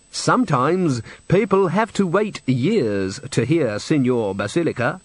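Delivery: slow, drawn-out prim boasting. Acted. Manager talks up his singer to the witches, primly. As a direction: stretch each phrase with stiff importance.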